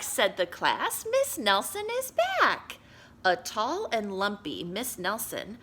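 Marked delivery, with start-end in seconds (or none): 1.1-2.8 put-on high, loud excited kids. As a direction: squeal it like a class of kids. Class voiced excitedly.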